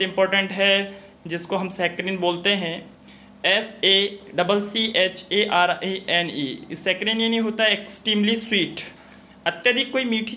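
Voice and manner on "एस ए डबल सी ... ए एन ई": very slow letter-by-letter spelling; drawn-out classroom spelling. Shown as a demonstration. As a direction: dictate the letters one by one, slowly. Teacher spells the word out.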